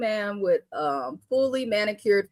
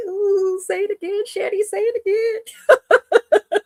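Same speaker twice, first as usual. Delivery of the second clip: high, giddy plea breaking into laughter; retold giddy reaction, very high; delighted squeal; 12 semitones higher, 3 dB louder than usual. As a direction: squeal it like a tickled fan. Delighted reaction to friend's accent.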